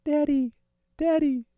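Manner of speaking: very high, loud falsetto; sex robot woman. Pretend. Host demonstrates what the robot says, in high mock falsetto.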